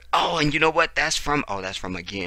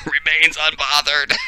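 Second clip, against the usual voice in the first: higher, louder words through laughter; laughing; 5 semitones higher, 5 dB louder than usual. Real, not acted. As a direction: repeat it while cracking up. Laughs while repeating the meme.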